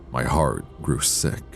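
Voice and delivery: ordinary delivery, nothing marked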